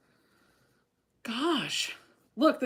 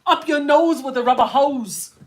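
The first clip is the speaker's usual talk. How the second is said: catchphrase voice; 5 semitones higher, 9 dB louder than usual. Retold or imitated. Quoting Welcome Back Kotter catchphrase.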